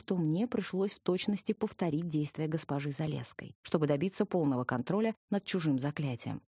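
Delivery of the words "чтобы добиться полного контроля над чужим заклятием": ordinary delivery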